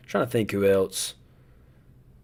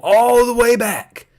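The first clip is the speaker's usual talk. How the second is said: high, loud, drawn-out hype; excited, booming stream-host build-up; hyped-up announcement; 11 semitones higher, 7 dB louder, slower than usual. Real, not acted. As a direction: stretch it out big, pitched way up. Hyping a returning game's comeback.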